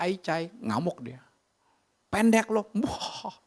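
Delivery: high, urgent preacher's cry; fiery sermon climax, voice soaring; impassioned preaching. Acted.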